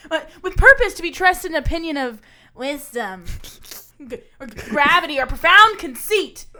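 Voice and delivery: loud, building stage declamation; declaim, building to the end; dramatic monologue. Shown as a demonstration. Actor performing the monologue for a friend, very loud. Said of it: "Here's how I would do this one".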